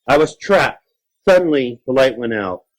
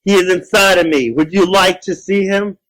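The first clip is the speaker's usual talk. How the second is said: raised-pitch monster taunt; taunting creature; 9 semitones higher, 4 dB louder than usual. Acted. Creature offers child a look at neighbor, taunting.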